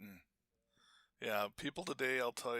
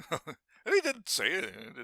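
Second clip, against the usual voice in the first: laughing through the words, higher and louder; 6 semitones higher, 7 dB louder than usual. Real, not acted. Remembering the silent German Shepherd, laughing. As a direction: crack up as you say it. Laughing while retelling about the dog.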